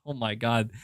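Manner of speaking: normal talking voice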